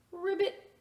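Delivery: high playful ribbit; frog ribbit. Acted. Little boy cheering up the man, high ribbit. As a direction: croak it sweetly like a frog.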